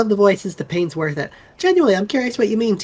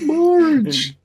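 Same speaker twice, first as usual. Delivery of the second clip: higher, hammed-up Homer Simpson voice; 9 semitones higher, slower than usual. Shown as a demonstration. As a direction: do Homer calling for Marge. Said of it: "Homer Simpson".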